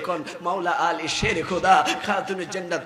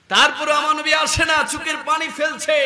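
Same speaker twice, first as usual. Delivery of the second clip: high, loud narration; impassioned preaching; 8 semitones higher, 5 dB louder than usual. A narrator's reading. Preacher tells listeners the Prophet still weeps, impassioned. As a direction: cry out the story at a sustained high pitch.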